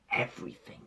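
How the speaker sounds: hushed awed whisper; whispered everything. Real, not acted. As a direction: breathe the word in awe. Excited whispered aside.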